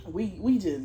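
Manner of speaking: ordinary delivery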